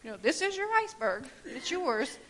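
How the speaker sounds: lightly voiced, gently insistent God. God telling her the iceberg is hers, lightly voiced. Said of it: "And he's saying".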